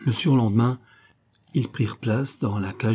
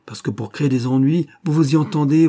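rising, louder jab of reproach; cutting reproach; 6 semitones higher, 5 dB louder than usual. Acted. Officer blaming the witness for the trouble, reproachful. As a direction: throw it accusingly at the accused. Officer turns on the accused woman.

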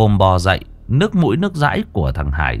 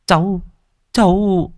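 stammering fear; 10 semitones higher than usual. A narrator's reading. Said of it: "Phùng ú ớ".

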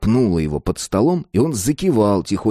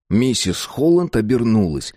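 ordinary delivery, nothing marked

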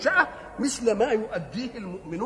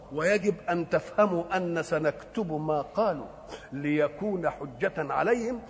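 normal talking voice

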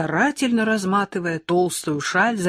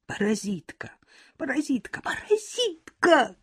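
starts breathy, climbs higher to a shrieking cry; whisper building to shout; 9 semitones higher than usual. A narrator's reading. Valechka screaming at Lyolka over the apple, building to shout. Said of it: "кричит Валечка".

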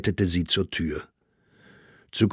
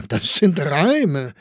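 higher, louder teasing Viennese crony; teasing Grossinger; 7 semitones higher, 4 dB louder, much slower than usual. A narrator's reading. Grossinger teases Saxberger about his absence, mock-jovial. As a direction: needle him with a knowing laugh.

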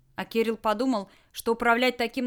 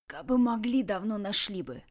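wheezing rasp. Acted. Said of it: "просипел с пола Ред".

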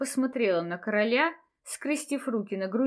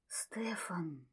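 whisper; 3 semitones lower, 13 dB quieter than usual. A narrator's reading. Ryan whispering the name.

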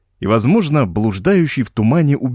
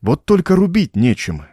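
usual speaking voice

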